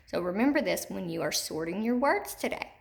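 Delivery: ordinary delivery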